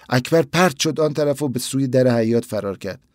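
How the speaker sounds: plain reading voice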